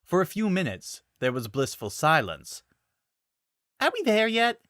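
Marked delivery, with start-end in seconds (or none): 3.8-4.6 put-on whiny kid. Impatient passenger asking en route.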